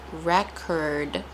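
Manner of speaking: record said REK-erd, curd ending; noun pronunciation. Shown as a demonstration. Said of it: "more stress on the ending".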